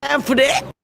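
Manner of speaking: high, loud sassy quote; imitating Sweet Brown's catchphrase. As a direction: toss it off like the famous clip. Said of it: "as Sweet Brown would say".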